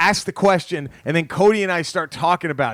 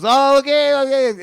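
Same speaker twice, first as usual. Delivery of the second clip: mock mobster greeting; 9 semitones higher, 6 dB louder than usual. Retelling a gangster greeting.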